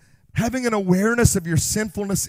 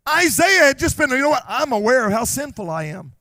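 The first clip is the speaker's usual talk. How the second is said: loud, high preaching in rising fervour; full-force preaching; 5 semitones higher, 4 dB louder than usual. Acted. Preacher proclaiming God's grace to the church, loudly. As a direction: drive the grace home to the church.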